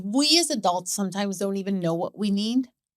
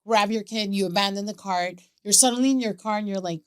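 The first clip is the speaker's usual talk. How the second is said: normal talking voice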